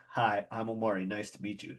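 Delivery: low, soft, matter-of-fact greeting; understated introduction. Retold or imitated. Retelling how he introduced himself, understated. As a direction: replay it as nothing special. Replaying his own plain introduction.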